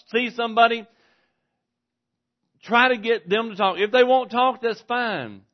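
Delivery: loud, high, driving sermon voice; full-force preaching. Acted. Preacher pressing his congregation, loud full-force preaching. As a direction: preach it hard to the back pews.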